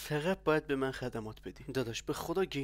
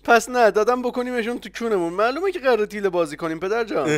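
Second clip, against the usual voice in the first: mocking laugh; 6 semitones higher, 11 dB louder than usual. Mocking reply, laughing loudly.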